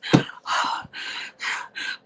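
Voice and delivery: a sudden breathy gasp of fright; sharp gasp. Acted. Mother bursting into the bedroom gasps in fright. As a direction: gasp as the door flies open.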